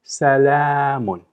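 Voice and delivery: Salamun drawn out, stress on long la; slow model pronunciation. Shown as a demonstration. Teacher repeating the word for copying, slow. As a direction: say Salamun slowly for the class. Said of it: "try to do it".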